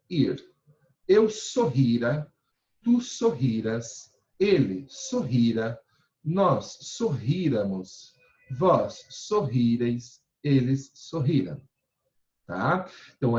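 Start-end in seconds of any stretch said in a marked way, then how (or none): 1.1-11.6 slow, deliberate conjugation drill; teacher drilling verb forms; slow recitation. Shown as a demonstration. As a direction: spell out each form slowly for the class. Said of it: "teacher reciting conjugation slowly".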